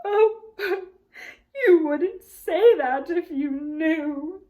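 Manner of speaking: slow, loud, broken sobbing. A narrator's reading. Tumnus sobbing as Lucy comforts him. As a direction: speak through the sobs. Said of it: "between his sobs".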